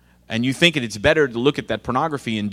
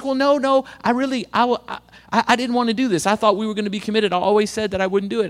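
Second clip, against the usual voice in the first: put-on higher, pleading, stumbling teen voice; flustered whiny protest; 8 semitones higher than usual. Guilty teen pleading with his pastor, high and flustered. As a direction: protest in a pitched-up panic. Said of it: "He's like".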